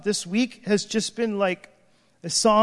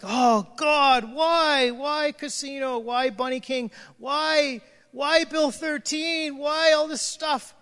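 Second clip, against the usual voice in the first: anguished cry to God; 6 semitones higher, slower than usual. Retold or imitated. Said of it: "and I'm just like".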